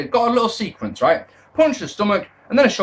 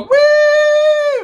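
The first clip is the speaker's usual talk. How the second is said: mock cheer; 7 dB louder than usual. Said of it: "They go".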